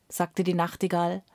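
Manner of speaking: ordinary delivery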